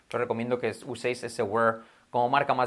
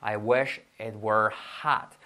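very slow, drawn-out English example; slow model sentence; 3 semitones lower, much slower than usual. Shown as a demonstration. Teacher models English example for learners, slowly. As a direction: spell out each word unhurriedly.

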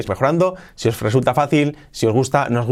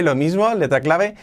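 usual speaking voice

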